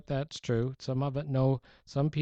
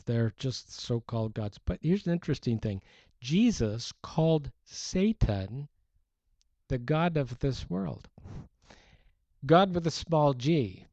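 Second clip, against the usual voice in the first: ordinary talk, nothing marked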